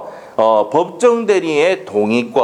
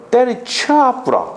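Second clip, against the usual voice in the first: high, drawn-out Gyeongsang dialect; 11 semitones higher, much slower than usual. Shown as a demonstration. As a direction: stretch it out in thick Gyeongsang intonation. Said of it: "경상도 사투리는 뭐라고?".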